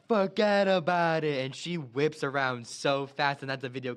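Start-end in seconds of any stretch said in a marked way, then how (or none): 0.1-1.4 loud, slow New York "forget about it"; New York tough guy. Retold or imitated. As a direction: drag it out, louder, like a mob wiseguy. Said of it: "he's like".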